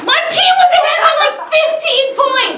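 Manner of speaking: high, fast indignant correction; mock outrage. Real, not acted. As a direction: blurt it out, offended. Correcting the number, exclaiming.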